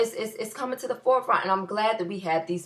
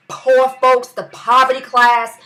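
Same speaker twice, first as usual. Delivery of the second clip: louder, punching each class and name; loud rant; 3 semitones higher, 8 dB louder than usual. Real, not acted. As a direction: shout the list at Cosby.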